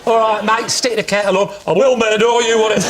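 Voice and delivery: hammed-up northern matey voice; northern accent. To the electrician, chatting in a put-on northern accent. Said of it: "my usual of getting more northern".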